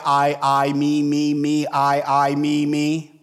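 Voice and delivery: droning, evenly pitched string of words; mock self-focused. Preacher mimics the rich man in the parable, self-focused monotone chant. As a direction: imitate a self-absorbed man on one monotone note. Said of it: "He's self-focused".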